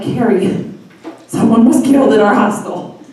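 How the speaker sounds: loud, urgent report to a friend; mock-panicked phone voice; retold panicked call. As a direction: break terrible news in hammed-up alarm.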